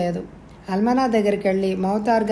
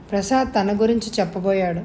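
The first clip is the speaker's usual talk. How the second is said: ordinary delivery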